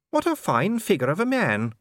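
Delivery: high, faint lady's voice; a lady forcing a weak compliment; weakly said. A narrator's reading. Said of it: "said Sybil weakly".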